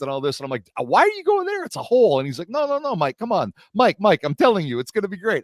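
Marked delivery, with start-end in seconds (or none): 0.8-2.2 high-pitched, exasperated retelling of a warning; incredulous protest. Retold or imitated. Warning his buddy off San Francisco, exasperated. 2.5-5.4 higher-pitched imitation of a persuading friend; insistent reassurance. Buddy Nick persuading him about the trip, insistent.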